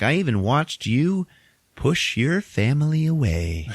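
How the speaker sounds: high, drawn-out singsong taunt. Retold or imitated. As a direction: taunt him with swooping high pitch. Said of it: "he's taunting him".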